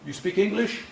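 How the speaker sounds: a hint of a tentative foreigner's question; newcomer asking for help. Newcomer to local on the street, questioning. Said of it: "if somebody off the boat came up".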